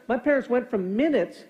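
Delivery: usual speaking voice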